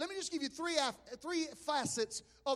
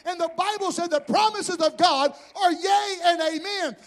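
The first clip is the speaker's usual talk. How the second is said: loud, rising, shouted sermon; preaching at full force; 6 semitones higher, 12 dB louder than usual. Acted. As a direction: drive it home with a shout to the congregation.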